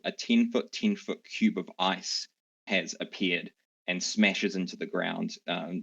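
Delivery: ordinary talk, nothing marked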